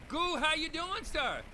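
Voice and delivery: loud, high welcome; excited greeting. Real, not acted. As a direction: greet him big. Greeting guest live.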